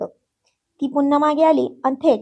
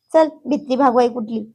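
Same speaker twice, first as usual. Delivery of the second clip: ordinary delivery, nothing marked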